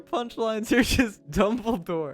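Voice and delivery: high squealing laughing shut ups; shrieking with laughter at a dumb joke. Real, not acted. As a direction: squeal it out while cracking up. Cracking up at chat joke.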